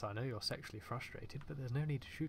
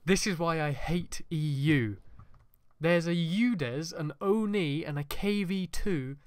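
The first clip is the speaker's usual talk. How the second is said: loud, raised-pitch rant, drawn out; exasperated outburst; 4 semitones higher, 12 dB louder than usual. Real, not acted. Player ranting at absurd tanks behind him, loud and exasperated. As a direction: shout it out in real disbelief.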